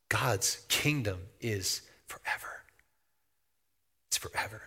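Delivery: quiet reverent whisper. Acted. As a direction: breathe it out softly. Preacher drops to hushed reverence.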